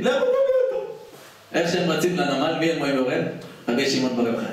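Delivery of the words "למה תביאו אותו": surprised. Acted. King asks indignantly.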